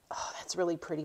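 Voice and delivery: soft awed whisper; whispered aside. Real, not acted. To herself, noticing a pretty bead, a soft whisper. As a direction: murmur it to yourself in delight.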